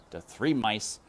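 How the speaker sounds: plain reading voice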